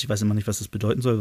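usual speaking voice

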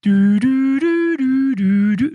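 hold beeps. Retold or imitated. Mocking a phone hotline queue.

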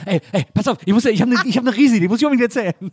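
high, loud and rushed like on speed; hyped-up sped-up voice. Retold or imitated. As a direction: gabble it out like a hyper caller. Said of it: "als wäre jemand irgendwie tierisch drauf".

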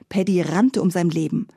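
ordinary delivery

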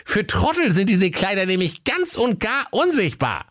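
very high, confiding salesman voice; sly shopkeeper. Acted. Höke explains clothes invisible to fools, high and sly. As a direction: pitch the swindle in a high comic voice.

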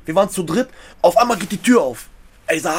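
ordinary talk

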